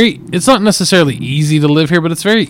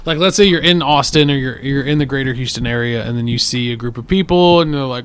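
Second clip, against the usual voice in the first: usual speaking voice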